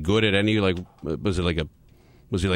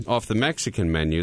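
usual speaking voice

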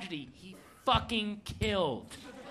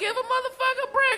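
much higher, fast whine; whiny roommate; 3 dB louder, faster than usual. Retold or imitated. Roommate begs for a break, high and fast. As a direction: squeal it like a put-upon guy. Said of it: "And he's like".

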